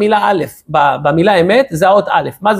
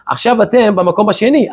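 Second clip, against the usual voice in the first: ordinary talk, nothing marked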